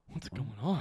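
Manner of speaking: dazed. Retold or imitated.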